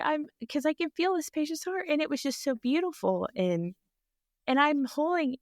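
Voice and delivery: ordinary talk, nothing marked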